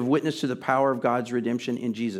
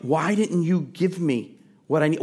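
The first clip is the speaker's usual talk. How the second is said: ordinary delivery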